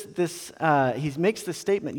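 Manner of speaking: ordinary talk, nothing marked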